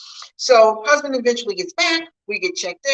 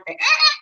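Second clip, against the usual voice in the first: yelp; 5 dB louder than usual. Real, not acted. Husband forgot something.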